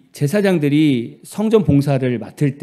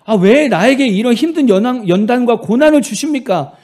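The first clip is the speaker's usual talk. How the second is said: arguing with God; 5 semitones higher, 5 dB louder than usual. Retold or imitated. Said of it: "하나님께 따지더라는 거예요".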